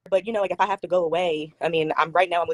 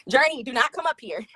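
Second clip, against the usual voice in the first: calling out; 7 semitones higher than usual. Real, not acted. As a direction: call up the stairs at a higher pitch. Calling to her daughter upstairs.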